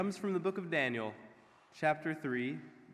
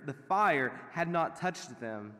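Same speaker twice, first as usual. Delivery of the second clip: usual speaking voice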